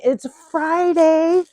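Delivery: high, loud, drawn-out announcement; excited cheer. Real, not acted. Host greeting viewers at the weekend, excitedly cheering. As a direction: stretch it out high to hype the crowd. Hyping the audience for Friday.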